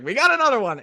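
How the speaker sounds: high, loud gleeful yell; celebratory cheer. Retold or imitated. Host celebrating a new guest to sister, high triumphant cheer. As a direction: cry it out in triumph. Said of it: "how I always celebrate".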